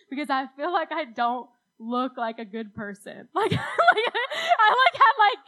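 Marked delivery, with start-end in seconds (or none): 3.3-5.5 high, slow words through real laughter; laughing. Real, not acted. Laughing while telling embarrassing story.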